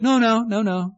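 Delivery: lightly acted motherly refusal; calm mother refusing. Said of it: "the calmness of a mother".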